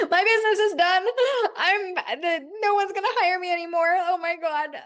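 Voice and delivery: hammed-up freak out, high and loud; retold freak out. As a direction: relive the panic, half-joking. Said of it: "had this whole freak out over it".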